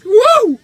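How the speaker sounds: high, loud cheer; loud whoop. Real, not acted. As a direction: whoop in celebration. Cheering a friend joining Twitter.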